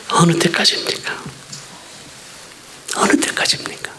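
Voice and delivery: hushed despairing whisper to God; a faint whispered cry of despair; whispered plea. Retold or imitated. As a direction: breathe the question out, barely voiced.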